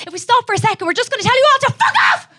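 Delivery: building from high, tense talk to a fast shout; hammed-up explosion of rage, speeding up; repressed rage erupting. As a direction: let it burst out as a high yell.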